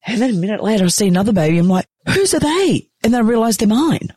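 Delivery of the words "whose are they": high-pitched disbelief, retold; baffled surprise. New mother seeing two babies, baffled surprise. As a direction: blurt it high, acting bewildered.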